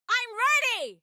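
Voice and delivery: high-pitched shout; ready call. Acted. Fighter signals companions to begin, high urgent shout.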